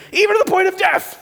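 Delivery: high, squeaky mock-whine of a sulking kid; whiny child Jonah. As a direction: mimic a pouty toddler throwing a fit. Mocking Jonah's childish complaint.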